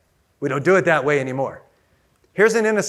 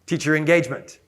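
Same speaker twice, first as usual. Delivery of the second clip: ordinary delivery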